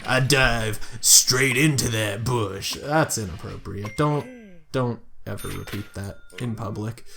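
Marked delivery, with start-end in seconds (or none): 0.0-2.8 slowing into hammed-up innuendo; mock suggestive. Reading the game's flirtation aloud, mock suggestive. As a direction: draw out the last line as a knowing innuendo.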